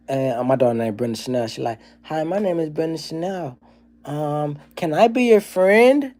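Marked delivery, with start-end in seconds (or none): 2.0-6.1 put-on high, slow, cheerful child voice; hammed-up sweet child asking to be friends; happy little kid. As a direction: sing it out like a jubilant little girl.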